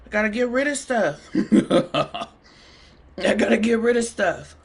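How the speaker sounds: giggling through the words; laughing. Real, not acted. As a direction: say it while cracking up. Laughs at her own remark.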